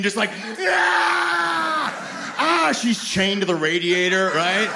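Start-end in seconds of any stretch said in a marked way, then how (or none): 0.6-2.3 high, raw battle scream; wild woman's war cry. Acted. Wife crashes through drywall at the date, screaming. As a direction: let out a crazed charging shriek. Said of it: "Olga comes crashing through the drywall".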